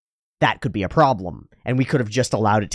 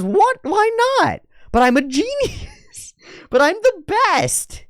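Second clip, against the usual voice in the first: much higher squeaky whine, slow at the end; whiny Cliff; 3 dB louder, slower than usual. Retold or imitated. Cliff protesting the rejection, high squeaky whine. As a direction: whine like a spoiled boy genius. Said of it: "He goes".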